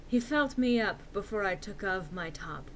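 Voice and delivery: usual speaking voice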